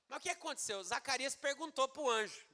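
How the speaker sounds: normal talking voice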